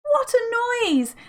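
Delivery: high, loud, slowed exclamation; aunt covering her ears, loudly exclaiming. A narrator's reading. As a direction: complain about the din. Aunt reacts to shouting.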